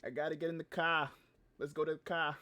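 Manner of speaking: mock Italian Long Islander; Long Island accent. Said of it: "Like, Italian Long Islander".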